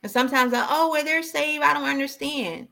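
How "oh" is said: normal talking voice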